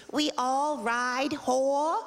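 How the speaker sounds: slow mock accent; mock Japanese accent. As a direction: say it slowly like a tiny royal. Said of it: "she goes like this".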